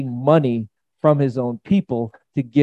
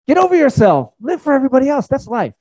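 "Get over yourself" higher and louder, pushed out; emphatic preaching; 10 semitones higher, 4 dB louder than usual. Real, not acted. Preacher urging congregation, high loud emphatic call. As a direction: hammer the point home.

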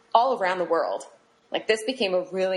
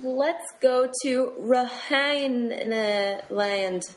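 slow put-on voice; 4 semitones higher, much slower than usual.

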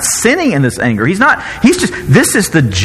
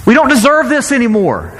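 rebuking Moses; 9 semitones higher, 5 dB quieter than usual. Retold or imitated. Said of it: "He's saying".

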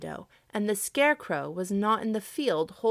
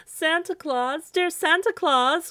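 high, loud, drawn-out plea; sobbing cry; 10 semitones higher, 7 dB louder, slower than usual. A narrator's reading. Scarecrow calls to Santa in the night, a sobbing cry. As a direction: cry out slowly and high, pleading. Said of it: "cried Jimmy Scarecrow with a great sob".

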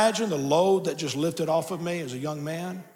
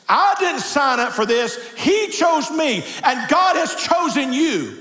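full-force preaching; 8 semitones higher, 6 dB louder than usual. Real, not acted. Pastor urges congregation to let go into God's hands, impassioned shouted preaching. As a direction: drive it home to the whole congregation, high and ringing.

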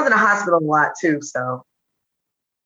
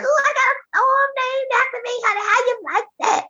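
very high-pitched, giddy boasting mom; imitating an excited mother, way up high. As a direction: squeal it proudly in a very high mama voice. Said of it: "She just excited".

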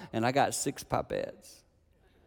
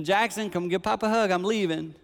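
louder and higher; calling out; 8 semitones higher, 5 dB louder than usual. Retold or imitated. Grandpa calling Jackson for a hug, louder call. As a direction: call the boy over, cheerfully. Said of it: "And then".

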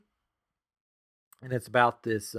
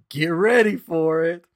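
loud, raised build-up to a big moment; eager hype; 5 semitones higher, 8 dB louder than usual. Retold or imitated. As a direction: hype it up for someone watching. Retold hyping up the wife.

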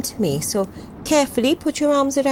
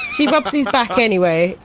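laughing; 2 semitones higher than usual. Real, not acted. Player laughing at awkward hug.

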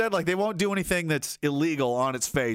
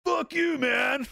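high shouted curse; hammed-up angry yell; acted shout; 11 semitones higher, much slower than usual. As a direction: spit it at the boss.

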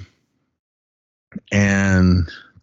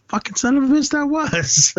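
much higher, comic mock outrage; shocked exclamation. Realizing the partiers were the news crew, high shocked curse. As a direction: shoot the pitch way up in stunned disbelief. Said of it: "And I was like".

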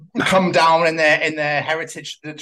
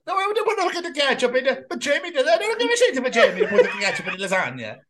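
high, hammed-up Italian protest; Italian accent; 7 semitones higher than usual. Italian denying the ketchup and blaming Jamie, high Italian accent. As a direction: argue back in a cartoon Italian voice, pitched up. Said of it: "does an Italian accent".

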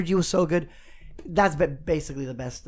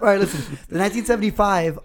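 ordinary talk

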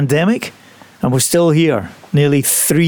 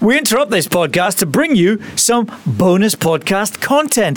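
announcer voice; 4 semitones higher than usual. Acted. Mock announcement.

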